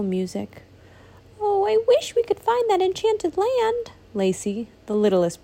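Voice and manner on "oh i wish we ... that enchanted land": put-on high, wishful little girl voice; little princess voice. The littlest princess wishing aloud, high and dreamy. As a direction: sigh it like a dreamy small child.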